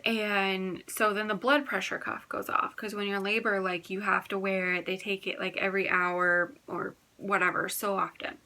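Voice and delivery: ordinary talk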